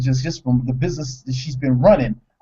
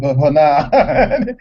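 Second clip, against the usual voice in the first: laughing; 4 dB louder, much slower than usual. Real, not acted. Joking about suing for custody.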